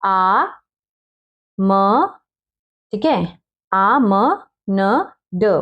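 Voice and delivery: आमंड split into sounds, आ, म, slow; spelled out slowly. Shown as a demonstration. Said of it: "इसको बोलते हैं आमंड". ("आ म न ड" धीरे धीरे अलग अलग बोलना)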